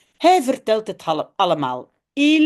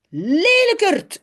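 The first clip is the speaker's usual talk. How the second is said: put-on childish insult; 9 semitones higher, 4 dB louder, slower than usual.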